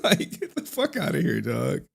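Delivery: trailing out of the squeaky character; put-on voice fading. Streamer to chat, dropping the squeaky voice. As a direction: still a bit high, brushing someone off.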